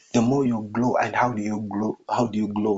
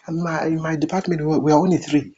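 higher-pitched imitation of a complaining leader; mock complaint; 6 semitones higher, faster than usual. Preacher mimics a leader of a small department, mock complaining.

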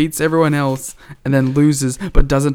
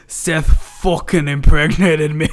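Irish impression drifting into Scottish; Becky; 2 semitones higher than usual. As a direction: let the hammy Irish accent slide Scottish. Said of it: "It went Scottish".

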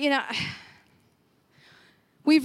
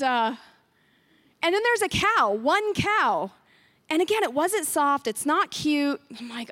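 ordinary talk

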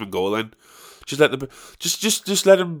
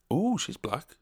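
much softer, hushed remark; nana's aside; 2 semitones higher, 11 dB quieter, slower than usual. Retold or imitated. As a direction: say it under your breath as if she's gone. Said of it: "me nana would a hundred percent say".

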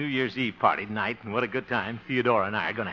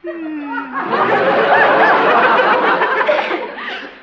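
loud high silly giggle; goofy giggle; 13 dB louder than usual. Acted. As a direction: giggle like a lovesick fool. Harold's goofy laugh.

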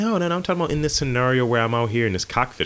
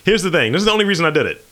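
ordinary talk

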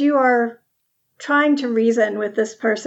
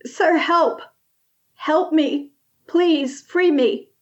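higher pleading cry of a caged prisoner; calling out for help; 5 semitones higher, slower than usual. Acted. Lightly voiced caged man calling out to the passing hero, higher pleading cry. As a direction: beg the stranger to free you, voice pushed up. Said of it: "calls out to you".

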